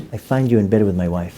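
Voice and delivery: low, soft laughing confession; deadpan husband. Retold or imitated. Husband telling the rabbi a secret, low soft deadpan. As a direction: deliver it low and dry. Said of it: "he tells me".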